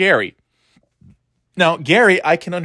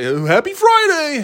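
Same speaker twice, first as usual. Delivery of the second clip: much higher chirpy greeting; mock cheery coworker. Mimicking a coworker in the break room, high mock cheer. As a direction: chirp it like an annoying office optimist. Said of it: "I'd be like".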